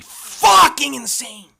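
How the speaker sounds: frustrated shout. Acted. Annoyed student ranting about a teacher's cursor, slow frustrated shout. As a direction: spell out how much it annoys you.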